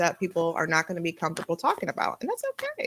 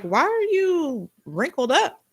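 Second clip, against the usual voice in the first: put-on high, slow, puzzled child voice asking dad; a curious little kid staring at dad's frown; childlike question; 8 semitones higher, 3 dB louder, slower than usual. Young daughter asks her dad about his wrinkles, high and slow. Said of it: "I was serious 'cause I would be like".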